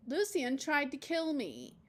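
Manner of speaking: put-on slow, whiny kid voice; a lightly voiced indignant schoolboy; child's accusation. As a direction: accuse him like an aggrieved boy.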